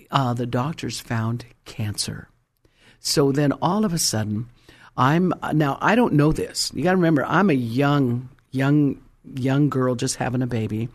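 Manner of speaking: ordinary talk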